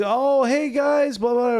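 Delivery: bright, high welcome call; cheery greeter. Retold or imitated. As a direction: greet them like an overeager host. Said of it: "saw us and was like".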